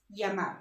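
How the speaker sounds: llamar said "ya-MAR"; double L as Y. Shown as a demonstration. Teacher explaining double L, llamar starting with Y. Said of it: "you pronounce it as Y".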